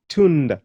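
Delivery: "Tunda" with a pure oo, toon-da; Swahili u vowel. Shown as a demonstration. Teacher modelling Swahili word for fruit. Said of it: "Can you pronounce that?".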